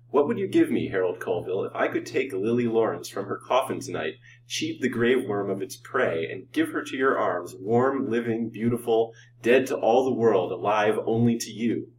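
ordinary delivery

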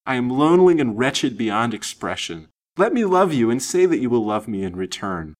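high, impassioned torrent; wild entreaty. A narrator's reading. Lonely lady pouring out her love to Lance, wild entreaty. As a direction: plead your case urgently and eagerly. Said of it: "she answered wildly".

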